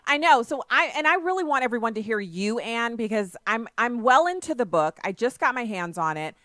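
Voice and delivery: loud, high, speaking up clearly. Real, not acted. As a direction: push the voice so the caller hears. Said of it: "Not really".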